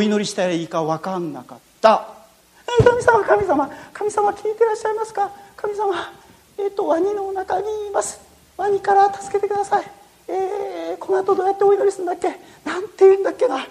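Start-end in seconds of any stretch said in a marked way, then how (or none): 2.8-13.7 higher, softer, hesitant prayer; nervous boy praying. Acted. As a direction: fumble through a first prayer. Boy nervously prays for first time. (初めて祈る少年のおどおどした祈り)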